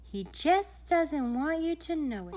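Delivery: a hint of a grand lady confiding; Madame de Géométrique. A narrator's reading.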